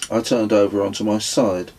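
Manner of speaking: ordinary delivery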